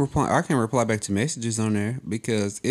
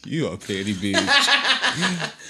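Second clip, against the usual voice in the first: slow, giggling mock insult; laughing tease; 3 semitones higher, much slower than usual. Real, not acted. Teasing a friend for shady answer, slow and laughing. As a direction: drag it out through laughter.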